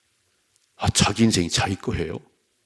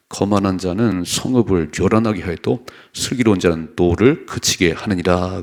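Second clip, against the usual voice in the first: loud, steady unison reading of the verse; scripture read aloud; 5 dB louder than usual. Real, not acted. Pastor leads the congregation in reading, loud and steady. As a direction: read the proverb out together, loud and even.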